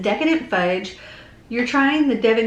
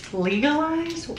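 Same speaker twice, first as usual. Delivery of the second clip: high, incredulous echo of "Legalized?"; surprised; 6 semitones higher than usual. Real, not acted. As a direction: say "Legalized?" as if you can't believe it. Echoes a word from the label in disbelief.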